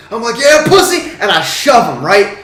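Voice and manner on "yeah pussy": taunting yell. Retold or imitated. Taunting the guy before shoving.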